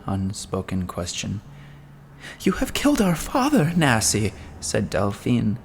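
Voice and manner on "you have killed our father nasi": much higher, shrieking accusation; accusing cry. Acted. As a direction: cry out the blame at her sister. Delphine accusing her sister.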